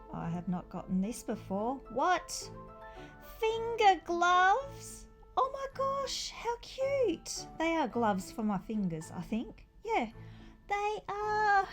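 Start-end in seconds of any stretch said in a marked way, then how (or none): none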